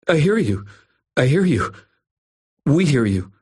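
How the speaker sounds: high, hurried repeats; scared bully hurrying to agree; frantic agreeing. A narrator's reading. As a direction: blurt it out to be let down. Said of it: "stammered the dangling brother".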